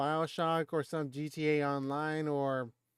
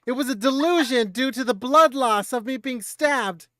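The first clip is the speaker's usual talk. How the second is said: dramatic shout; 8 semitones higher, 10 dB louder than usual. Acted. Dramatic dying roleplay.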